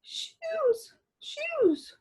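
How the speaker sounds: very high, breathy little murmur; a fainted lady mumbling; faint mutter. A narrator's reading. Dolores barely conscious, asking Douglas for his shoes, high and faint. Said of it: "muttered Dolores, oh so softly".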